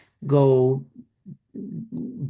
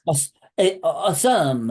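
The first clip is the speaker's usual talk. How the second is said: put-on Spanish accent; 3 semitones higher, slower than usual. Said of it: "I don't know how to do it in a Spanish accent".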